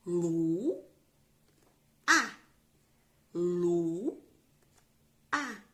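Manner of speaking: LU held long, pause, then a short separate A; "Lu. A." split syllable by syllable for children; sounding out syllables. Shown as a demonstration. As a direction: read "Lu. A." in two distinct beats. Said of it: "vamos juntar".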